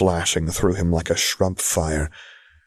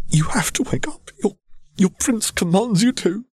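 high whispered hiss through teeth; 14 semitones higher than usual. Acted. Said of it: "hissed through his teeth".

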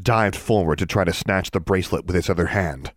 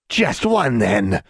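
high bargaining creature voice; 4 semitones higher, much slower than usual. Acted. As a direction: bargain slyly like a monster. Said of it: "the creature replied".

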